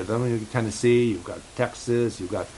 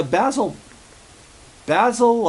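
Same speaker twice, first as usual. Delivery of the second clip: calling Basil, much higher and louder on the second call; 10 semitones higher, 4 dB louder, slower than usual. Acted. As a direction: call out to get Basil's attention. Host calls out to Basil.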